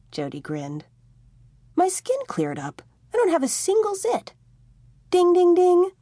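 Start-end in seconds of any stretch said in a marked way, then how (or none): 1.8-4.3 put-on much higher, thrilled teenage voice; excited discovery. A narrator's reading. Tommy tells Jody his skin cleared up, thrilled and high. 5.1-5.9 high, loud bell-like ding ding ding; game-show ding. A narrator's reading. Jody signals Tommy got the right answer, loud ding. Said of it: "Jody onomatopoeied".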